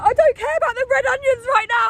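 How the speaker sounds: high, loud frantic wail; hysterical, hammed-up exasperation; re-enacted meltdown. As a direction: yell it in mock despair. Re-enacts meltdown over spilled shopping.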